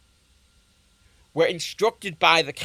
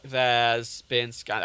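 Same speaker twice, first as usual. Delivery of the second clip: usual speaking voice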